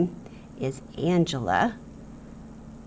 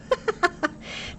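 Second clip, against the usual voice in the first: high, breathless giggle; amused, soft burst of laughter; 13 semitones higher, 4 dB quieter than usual. Real, not acted. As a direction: giggle at a silly drawing. Laughs at armless uncles.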